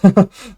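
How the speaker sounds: short, high, loud burst of laughter. Real, not acted. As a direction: let a real laugh burst out. Laughing at own goat accent.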